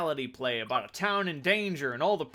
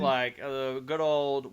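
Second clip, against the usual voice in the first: normal talking voice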